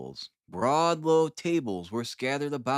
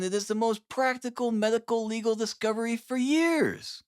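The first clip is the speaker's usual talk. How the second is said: higher, zealous scientist pressing his point; eager Holmes; 9 semitones higher than usual. Acted. Holmes urging Watson to see his bloodstain test, high and eager. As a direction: sell the discovery with fervour.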